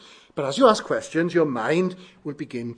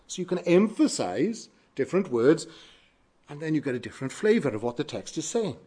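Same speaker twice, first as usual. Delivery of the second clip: normal talking voice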